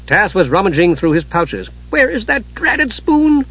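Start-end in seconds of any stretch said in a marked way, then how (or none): 1.9-3.5 kender voice. Acted. Tass voiced.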